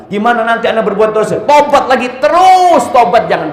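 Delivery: loud, high preaching that builds to a peak; fervent, climbing cry to the congregation; impassioned exhortation. Real, not acted. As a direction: urge them on, stretching the last words. Preacher urging endless repentance.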